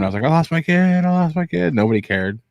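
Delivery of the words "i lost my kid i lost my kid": put-on high, frantic cry for a lost child, half-shouted; panicked mock scream; retold scream. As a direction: reenact a parent's panicked yelling, high-pitched. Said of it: "I was screaming".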